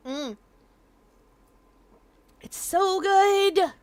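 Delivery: high, loud, long drawn-out "so good"; delighted food rave. Real, not acted. Streamer savoring homemade dumplings, delighted and drawn-out. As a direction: rave over it, stretching the words out.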